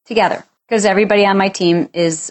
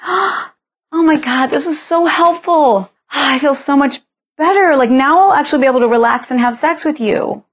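gushing relief; 7 semitones higher than usual. Pretend. Wife thanking husband for taking her tasks, gushing and much higher. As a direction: overplay the grateful sigh of relief.

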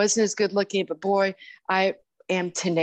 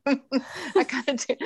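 slow, higher, laughing through the words; laughing repeat; 6 semitones higher, slower than usual. Real, not acted. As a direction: chuckle the punchline again, slowly.

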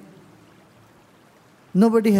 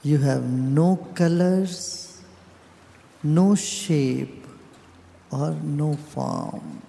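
guided meditation; 4 semitones lower than usual. Real, not acted. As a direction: guide them inward, calm and unhurried, with long pauses.

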